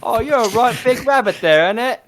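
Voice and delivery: high chirpy Cockney street urchin; old london cockney. Acted. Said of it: "my best pauper".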